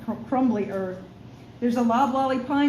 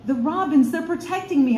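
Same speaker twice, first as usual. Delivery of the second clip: raised, higher insisting voice; louder voice; 6 semitones higher, 4 dB louder than usual. A narrator's reading. Zara raises her voice so the girl understands. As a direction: say it louder so she hears. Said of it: "I say in a louder voice".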